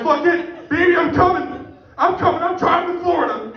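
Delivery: high-pitched excited declaration; mock fired-up resolve. Man announcing to his baby he's coming, mock excited shout. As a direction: call it out with hammed-up determination. Acts out sudden resolve to drive.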